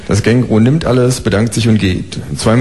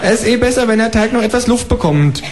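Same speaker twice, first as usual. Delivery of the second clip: high, chirpy kangaroo asking for eggs; 13 semitones higher than usual. Acted. Kangaroo asking the neighbour for eggs, high and chirpy. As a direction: pipe up like a polite cheeky kangaroo.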